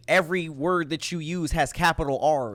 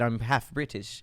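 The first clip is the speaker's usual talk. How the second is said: put-on British accent; 6 semitones lower, 6 dB quieter, slower than usual. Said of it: "That was actually pretty good".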